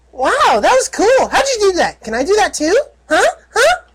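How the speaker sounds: put-on high, excited young girl voice; little filly voice. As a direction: squeal it like an awed little filly.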